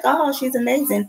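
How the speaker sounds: high-pitched cooing admiration; high mock gush; imitated gushing onlookers. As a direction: mimic a crowd gushing, voice way up.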